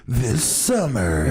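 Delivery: high, slow trailer announcer; hammed-up, drawn-out movie promo voice; action movie voice. Host showing off his voice acting, action movie voice. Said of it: "my action movie voice".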